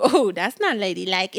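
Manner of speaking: lightly mock someone's prim objection; low-pitched mock disapproval; disapproving. Voices a disapproving prude.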